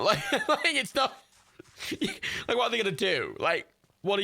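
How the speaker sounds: breaks into high giggles mid-sentence; laughing. Real, not acted. Explaining the living-room border to viewers, laughing.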